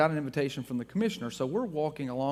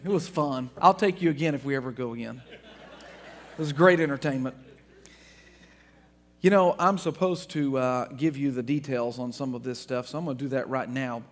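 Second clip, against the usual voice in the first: usual speaking voice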